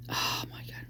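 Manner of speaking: voiceless, very soft exclamation; real hushed shock, barely voiced; stunned whisper. Real, not acted. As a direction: breathe it out in stunned disbelief. Shocked by the theory she reads.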